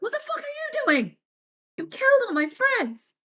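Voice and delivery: put-on high, tearful yell from the kid; crying furious kid. Teen to poisoning neighbor, high crying yell. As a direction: wail it through tears, cracking high. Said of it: "I was like".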